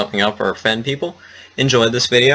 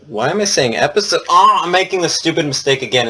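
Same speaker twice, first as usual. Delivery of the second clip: ordinary talk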